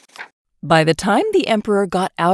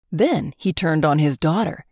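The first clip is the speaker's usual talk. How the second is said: ordinary delivery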